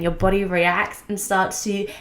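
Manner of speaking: normal talking voice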